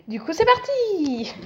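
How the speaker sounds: high, slow sing-out with a long drawn end; high-pitched drawn-out send-off; playful kickoff cheer. Real, not acted. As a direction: stretch the last word high, like a launch call. Launching the game, playful cheer.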